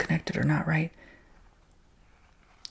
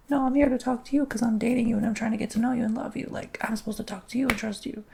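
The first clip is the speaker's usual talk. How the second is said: higher, louder insistent plea, half imitated; earnest protest; 9 semitones higher, 4 dB louder than usual. Pleading with an American boyfriend to just talk, raised and insistent. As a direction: push back hard, voice lifted, as if replaying it.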